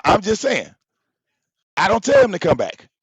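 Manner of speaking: ordinary talk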